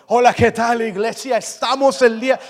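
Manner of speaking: loud, pompous stage-preacher voice; anointed preacher voice. Retold or imitated. Preacher grabbing the mic, in a put-on booming voice. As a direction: boom it into the mic like a fake preacher. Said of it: "vocecita de ungido".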